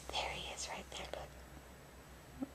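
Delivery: hushed whisper, pointing something out. Real, not acted. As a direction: whisper so you don't scare the owl. Pointing out hidden owl to child.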